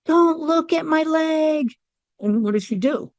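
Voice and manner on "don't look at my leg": yell. Retold or imitated. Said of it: "I yell".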